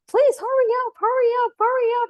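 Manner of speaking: high, loud begging to hurry up; desperate pleading. Retold or imitated. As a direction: beg the doctor through the pain. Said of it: "I was saying to him".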